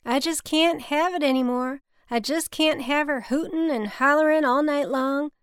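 high, loud Southern drawl, building exasperation; Bobby, thick Southern drawl. A narrator's reading. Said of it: "in his thick cotton drawl".

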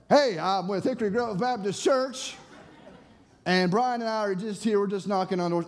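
high-pitched, hurried-to-explain greeting; over-eager introduction. Retold or imitated. Preacher explains himself to the suspicious husband, over-eager.

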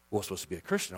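ordinary talk, nothing marked

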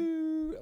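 high, loud "woo" like a leaping crab; long, high celebratory whoop; gleeful imitated whoop. Imitating leaping crab's joy.

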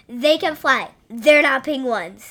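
insistent correction. Real, not acted. Co-host correcting the host about puffins, loud and firm. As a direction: drive the point home loudly. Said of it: "correcting the host".

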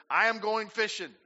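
high, loud declaration; Peter declaring. Retold or imitated. Preacher voicing Peter heading out to fish, bold. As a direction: announce it boldly. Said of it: "Simon Peter said to them".

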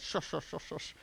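imitated cartoon shushing; shushing the wind. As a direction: hush a storm like a fussy child. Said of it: "the wind a bit and goes".

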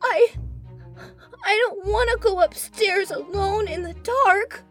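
put-on high tearful little girl; scared child sobbing; crying. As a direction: plead through tears, high and shaky. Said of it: "began to cry".

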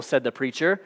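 ordinary delivery

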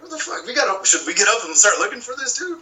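playfully alarmed, voice up high; mock alarm. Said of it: "We're like".

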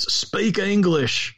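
put-on slow, drawled Southern accent; mock a rural Southern accent. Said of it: "my rural Southern accent comes out when I do that".

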